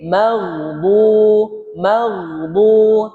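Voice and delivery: maghdu with a thick, unbouncing gh; heavy ghain. Shown as a demonstration. Teacher correcting the ghain for students.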